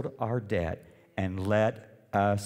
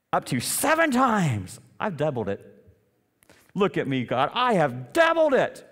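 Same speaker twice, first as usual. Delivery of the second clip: proud Peter; 5 semitones higher, 5 dB louder than usual. Acted. Peter boasting to Jesus.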